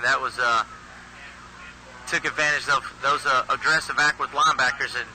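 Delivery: ordinary talk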